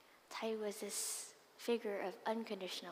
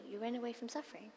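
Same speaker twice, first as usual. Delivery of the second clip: usual speaking voice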